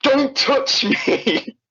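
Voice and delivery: high, slow, stretched-out mock shriek; mock scream. Stressed friend snapping at the narrator to stop touching her, a high mock shriek. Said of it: "she fucking almost screams at me".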